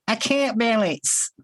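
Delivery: a quick mock Kiwi voice, drawn out; New Zealand accent. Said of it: "You can't say words like".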